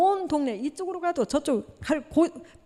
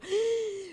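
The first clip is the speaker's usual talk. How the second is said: mock gasp; 9 semitones higher, 5 dB quieter than usual. Congregation hears the news.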